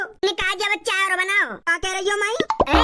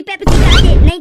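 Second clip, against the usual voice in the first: suddenly louder demand; loud call; 13 dB louder than usual. Acted. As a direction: raise the voice on the demand. Mother instructing son, sudden loud. (अचानक ऊँची आवाज़ में माँग)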